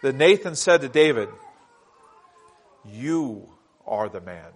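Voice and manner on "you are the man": low, slow prophet's verdict; solemn accusation. Acted. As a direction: point the finger and drop the voice.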